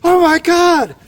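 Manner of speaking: high, loud panicked cry for help; retold scream for help. A camper screaming for help in his shelter, high and loud. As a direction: yell it like a man woken by raccoons. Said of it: "he was screaming".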